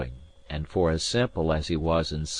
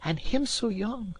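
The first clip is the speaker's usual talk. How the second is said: pitying cry; 3 dB quieter than usual. A narrator's reading. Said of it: "cries the lass".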